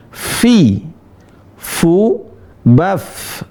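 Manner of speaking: "Fi, fu, baf" with long f and lifted voice; angkat suaranya. Shown as a demonstration. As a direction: lift the voice on "Fi, fu, baf", holding each f. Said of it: "Angkat suaranya". ("Fi, fu, baf" dengan f jelas dan suara diangkat)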